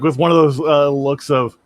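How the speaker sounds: ordinary talk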